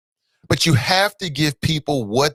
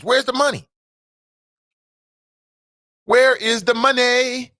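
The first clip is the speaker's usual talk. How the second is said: rising demand; 7 semitones higher than usual. Real, not acted. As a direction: call it out like a rallying chant. Repeated chant demanding money.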